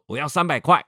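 提高嗓门大喊我要三百块; 对孩子厉声大喊索要; 大声喊. Retold or imitated. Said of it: "爸爸就更大的声音喊说". (父亲对儿子更大声地喊，吼叫)

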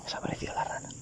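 hushed whisper to himself; disappointed whisper about the frog. Real, not acted. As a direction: breathe the words out. Frog vanished, aside.

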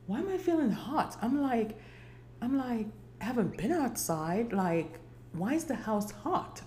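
ordinary delivery

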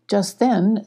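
ordinary delivery

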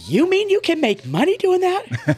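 high, loud imitation of an amazed kid; excited disbelief. Daughter learns sign-flaggers make money, acted excited disbelief. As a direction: squeal it as a thrilled daughter's question.

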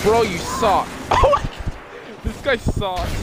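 raging gamer. Real, not acted. Gamer raging at bad teammate.

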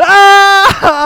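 loud, high jungle cry mid-leap; joyful Tarzan war whoop; Tarzan yell. Retold or imitated. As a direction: let out a Tarzan yell as you jump. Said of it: "just went Tarzan".

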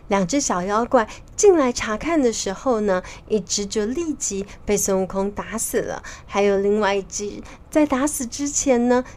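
ordinary delivery

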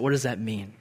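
usual speaking voice

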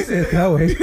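cracking up while talking; laughing through the words; laughing hard. Real, not acted. Laughing at the sexy voice.

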